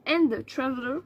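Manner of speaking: English read in a heavy French accent; deliberately bad French accent. Shown as a demonstration. Finishing the notebook's English motto for viewers, bad French accent. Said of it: "Avec mon bel accent anglais".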